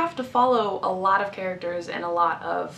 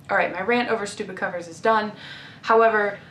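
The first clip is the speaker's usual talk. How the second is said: ordinary talk, nothing marked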